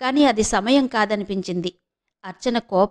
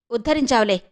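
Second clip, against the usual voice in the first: ordinary delivery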